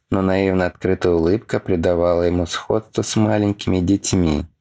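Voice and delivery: plain reading voice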